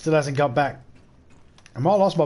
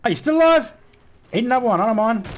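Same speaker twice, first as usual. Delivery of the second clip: high shouted taunt; loud; 8 semitones higher, 4 dB louder, faster than usual. Real, not acted. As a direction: yell it mockingly. Taunting a zombie.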